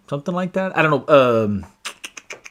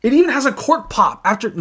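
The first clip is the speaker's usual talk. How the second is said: delighted laugh; 8 semitones higher than usual. Real, not acted. Delighted by the cork sound.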